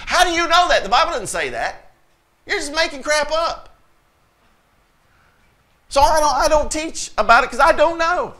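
high-pitched, exasperated protest; indignant exasperation. Real, not acted. Indignant rebuttal of speculators.

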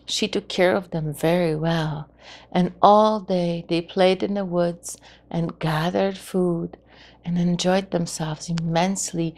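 ordinary delivery

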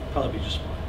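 normal talking voice

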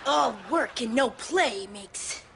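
slow, hammed-up movie-star impression; Jack Nicholson. Shown as a demonstration. Showing off impressions for a one-man show, slow celebrity impression. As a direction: draw it out slowly like a famous menacing actor. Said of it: "Jack Nicholson".

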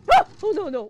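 loud jump-scare yelp; real fright; startled scream. Real, not acted. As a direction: shriek as it pops out.

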